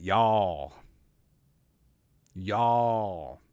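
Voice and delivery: a lightly drawled Southern "Y'all"; fake Southern accent. Mocking Brian Kelly's put-on drawl, a light Southern accent. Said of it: "his fake, uh, Southern accent".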